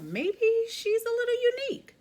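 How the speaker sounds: high, loud blurted remark; a sudden blurt in a high voice; dad blurting. Acted. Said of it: "Dad blurted out".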